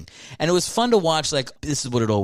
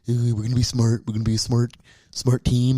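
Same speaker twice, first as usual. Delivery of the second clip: mild mock dweeby coach; prim, careful press-conference voice; a hint of a timid coach; nerdy coach impression; faster than usual. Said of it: "he's like".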